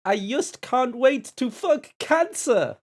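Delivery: high, slow, loud mock Swedish accent; mock Swedish accent shouted slowly. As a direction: ham up a Swedish accent, drawn out. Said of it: "in a Swedish accent".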